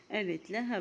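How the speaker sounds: usual speaking voice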